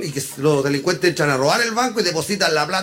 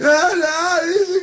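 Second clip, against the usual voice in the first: high tearful mock wail; mock crying; 11 semitones higher, much slower than usual. Crying friend answering his compadre, mock crying. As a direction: answer through hammed-up sobs. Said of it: "venía llorando y dice".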